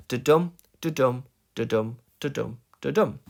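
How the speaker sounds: "Da-dum" in pairs, stress on the DUM; rhythm demo. Shown as a demonstration. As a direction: beat out each "da-dum" evenly, weak then strong. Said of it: "It's the rhythm of the line".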